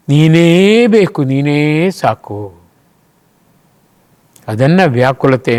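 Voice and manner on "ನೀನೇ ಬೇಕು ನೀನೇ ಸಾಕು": slow, drawn-out cry to God; louder at first, then easing off; devotional plea. Acted. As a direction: stretch the words out, calling on God. Acted tearful plea to God.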